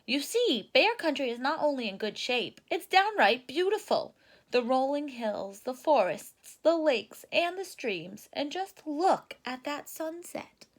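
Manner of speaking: lightly hammed-up Papa Bear. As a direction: show off the view like a proud dad. Papa Bear praising the view.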